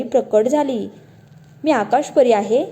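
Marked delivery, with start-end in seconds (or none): none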